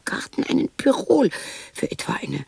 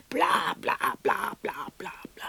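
whispered bla, bla fading to nothing; dismissive bla bla; 3 semitones lower, 5 dB quieter than usual. Acted. Woman waving off her own musings with fading whispers. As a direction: wave it off, ever softer.

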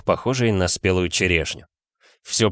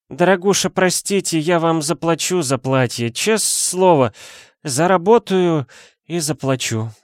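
put-on drunk woman; 9 semitones higher than usual. A narrator's reading. Drunk Dasha apologizing.

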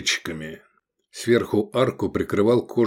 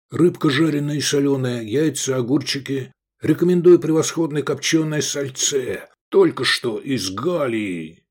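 higher, ingratiating patter; burbling innkeeper; 5 semitones higher than usual. A narrator's reading. Innkeeper listing food to guests, burbling. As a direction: purl the menu like an eager host. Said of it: "зажурчал Агатон".

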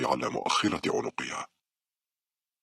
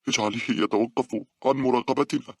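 high, upset plea; tearful; 11 semitones higher, 3 dB louder than usual. A narrator's reading. Weeping girl begs her friend for help, tearful. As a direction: plead as if close to crying. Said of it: "بكت باولا وهي تقول".

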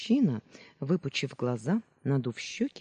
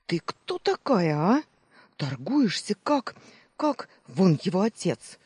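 raised, drawn-out astonished questioning; incredulous challenge; 5 semitones higher, 4 dB louder than usual. A narrator's reading. Baker baffled by the girl's haggling, incredulous. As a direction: demand who on earth this is. Baker mock-interrogates the hard bargainer.

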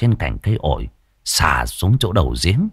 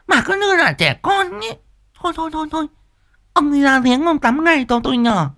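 much higher, scolding shrill wife voice; high scolding old wife; nagging wife Liên. Acted. Wife Liên's voice.

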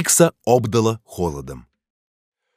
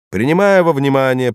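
formal, raised judge voice; stern judge; 6 semitones higher, 4 dB louder than usual. Acted. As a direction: read out the verdict like a judge. Judge pronounces sentence. (строгий голос судьи)

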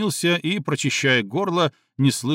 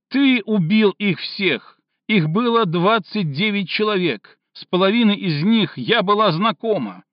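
furious accusation; 7 semitones higher than usual. Acted. Cindy accusing Stinov of the deaths, furious shouting. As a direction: hurl the blame at him.

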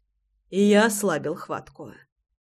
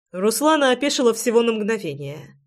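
ordinary delivery

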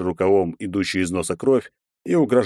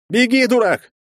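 high, loud panicked yell; inner voice yelling; 6 dB louder than usual. Acted. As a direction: scream a warning at yourself. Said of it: "внутренний голос вопит". (вопль внутреннего голоса)

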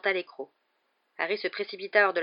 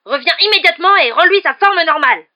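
furious high-pitched bellowed order; hurlement; 11 semitones higher, 17 dB louder than usual. A narrator's reading. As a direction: yell for the boy to come back at once. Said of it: "hurla-t-il". (ordre hurlé, voix aiguë)

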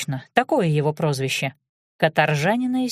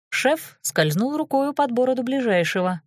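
usual speaking voice

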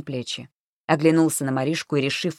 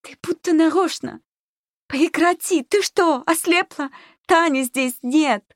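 very high, loud hysterical shrieking; shrill, frantic outburst; voice breaking into shriek; 13 semitones higher, 5 dB louder, slower than usual. A narrator's reading. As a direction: scream it at her, pitched right up. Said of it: "Голос сорвался на визг".

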